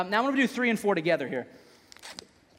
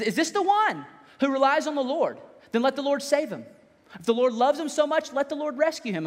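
mocking yell; 7 semitones higher than usual. Passers-by taunt Jesus on the cross, mocking and raised. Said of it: "mocking that they yell out".

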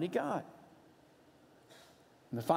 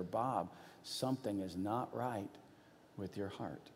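inner self-rebuke; 4 semitones lower, 6 dB quieter than usual. Retold or imitated. Inner self-rebuke on ride home.

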